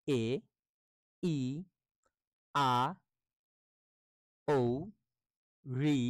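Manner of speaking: each letter said slowly and clearly, with long pauses; reading the letters. Shown as a demonstration. Teacher reads the vowel letters aloud as a demonstration. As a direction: model each vowel for the class, one by one. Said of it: "আমি একটু পড়ে দিচ্ছি".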